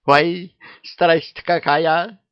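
high, slow gasping fright; frightened. Acted. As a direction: recoil with mock terror. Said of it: "шарахнулась в сторону ведьма". (испуганно)

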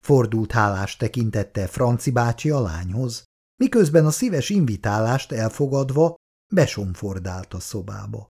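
plain reading voice

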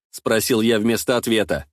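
plain reading voice

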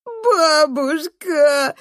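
high, loud, very drawn-out cry; straining wail. A narrator's reading. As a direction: howl for grandma with all your might. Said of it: "надрывается он". (надрывный детский вопль)

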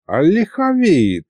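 slow, menacing drawl; drawn-out threat. A narrator's reading. Grandpa Mikhalych suspects the sorcerer, slow and menacing. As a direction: stretch it with a hint of menace. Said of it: "вдруг с угрозой протянул Михалыч".

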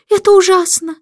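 high, loud lament from the queen; wailing queen. A narrator's reading. Queen wails in horror at the king's answer, high and loud. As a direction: wring your hands and wail it out. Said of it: "запричитала королева".

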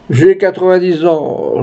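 put-on high, slow old man's voice; lightly acted elderly tremble; quavering old man. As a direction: quaver it slowly like an ancient man. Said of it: "chevrota un vieillard".

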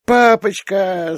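put-on high, slow little-girl squeal; a small daughter squeaking with joy; squeaky child. A narrator's reading. Little daughter squeaks for her daddy. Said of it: "запищала Лея".

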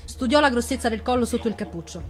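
ordinary delivery, nothing marked

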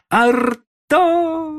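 very high, loud, stretched call; long drawn-out shout. Acted. Boy calling the lost dog, long loud shout. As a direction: call the name long and far. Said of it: "ещё раз закричал протяжно".

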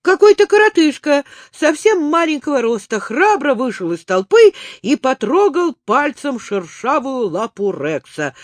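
plain reading voice

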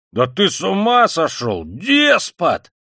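high, loud, stretched-out bellow; roaring. A narrator's reading. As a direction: roar in outrage. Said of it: "заревел Разумихин". (ревёт, громко и протяжно)

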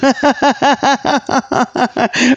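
longer amused laugh. Real, not acted. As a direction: laugh at your own apology. Laughs after mock apology.

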